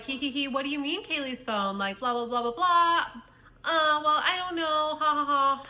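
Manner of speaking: mock the drunk friends' careless cackle; drunk giggly friends. Imitating the drunk friends on the phone, lightly giggly. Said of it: "They're so fucked up".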